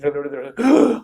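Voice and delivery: quick high gasp mid-sentence; gasp for air. Shown as a demonstration. Tutor mimicking a student running out of air, gasping. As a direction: gulp a breath and keep going. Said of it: "but you're doing this".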